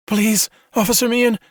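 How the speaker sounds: high, pleading frightened whimper. A narrator's reading. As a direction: beg through fear. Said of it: "Cassie whimpered".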